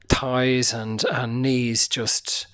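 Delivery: ordinary delivery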